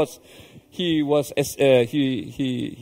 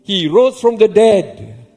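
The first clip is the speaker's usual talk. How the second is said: "He rose from the dead" slow, loud and higher; emphatic repetition; 5 semitones higher, 6 dB louder, slower than usual. Shown as a demonstration. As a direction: proclaim "He rose from the dead" slowly and loudly. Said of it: "Again, the emphasis".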